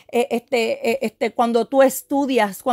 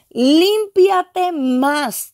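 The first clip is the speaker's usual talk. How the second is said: high, drawn-out proclamation; prophetic command; 5 semitones higher, 4 dB louder, much slower than usual. Retold or imitated. Preacher voices the Holy Spirit commanding cleansing, high drawn-out proclamation. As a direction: stretch each word into a ringing, slow cry.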